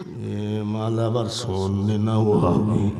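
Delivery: very low, slow grumble; low, drawn-out mock contempt; mock disdain. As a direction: drag it out low like a snubbing cleric. Mocks one cleric snubbing another.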